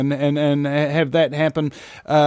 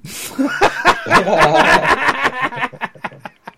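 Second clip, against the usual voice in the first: punchline laugh; 9 semitones higher than usual. Real, not acted. Commentator cracking up at own alien joke, high laughter. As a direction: crack up hard, high and long.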